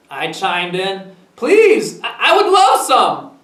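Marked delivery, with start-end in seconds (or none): 1.3-3.3 high, loud, eager acceptance; eager chiming in. A narrator's reading. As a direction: pipe up keenly across the lawn. Child chimes in, calling back.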